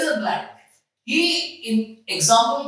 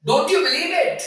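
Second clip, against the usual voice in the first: raised pitch, questioning surprise; 7 semitones higher than usual. Acted. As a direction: act out mild disbelief at being doubted.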